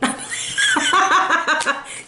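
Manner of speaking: a long, high real laugh; cracking up at her own joke. Real, not acted.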